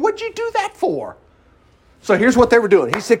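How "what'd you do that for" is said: high-pitched complaint; Jesus. Retold or imitated. Preacher voicing Jesus complaining to his people, mock complaining. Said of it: "Jesus is complaining here, saying".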